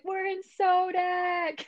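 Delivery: slow, high drawn-out "Sodak"; hyped traveler. Retold or imitated. Mimicking airport crowd excited about Sodak. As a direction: stretch it out like a thrilled tourist. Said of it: "Everyone was like".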